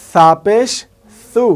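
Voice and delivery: সা and সু as "thaa" and "thoo", tongue on upper teeth; lisped "th" for the স in সা পেশ সু; tongue-tip "th" demo. Shown as a demonstration. Teacher recalling where letters are formed, "th" demo on সু. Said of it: "জিব্বার আগা".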